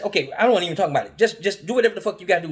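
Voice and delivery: fast, loud irritated brush-off; impatient rant. Retold or imitated. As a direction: rattle it off fed up.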